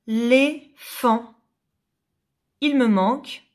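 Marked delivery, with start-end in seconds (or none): 0.1-1.4 slow "l'éléphant" without its first syllable, lé... phant. Shown as a demonstration. Teacher giving a word puzzle, "l'éléphant" in separate syllables. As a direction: pause between the last two syllables of "l'éléphant". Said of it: "la syllabe qui manque".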